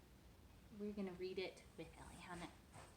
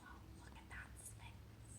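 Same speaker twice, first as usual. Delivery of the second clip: whisper; 10 dB quieter, slower than usual. Real, not acted. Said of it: "whispering aside to child".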